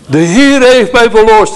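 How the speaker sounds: high, loud shout of joy for the dying man; joyful cry. Retold or imitated. Pastor voices the dying man crying out in joy. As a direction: cry it out in jubilation, high and ringing. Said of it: "riep hij".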